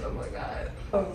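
normal talking voice

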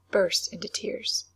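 usual speaking voice

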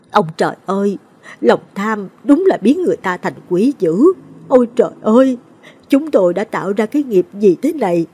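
grieving mother's anguished outcry; anguished wail. A narrator's reading. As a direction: lament to the heavens, voice breaking upward. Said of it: "gào lên trong đau đớn".

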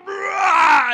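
loud, high lion roar. Acted. Preacher voices the lion pelted with rocks, roaring. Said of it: "And the lion goes".

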